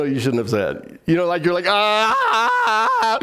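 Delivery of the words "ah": high-pitched mock cry of regret; hammed-up drawn-out "ah"; mock wail. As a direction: wail as if you wish it unsaid. Acts regret at words blurted out.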